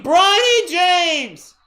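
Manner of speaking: building to a huge, high, drawn-out shout; excited play-by-play call. Real, not acted. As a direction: explode on the player's name, stretching it out. Live call of a three-pointer.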